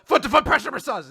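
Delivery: high, loud, rushed battle cry; attack-move shout. Acted. Recapper acts the girl attacking his foot, mock battle cry. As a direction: yell the move's name, hammed-up.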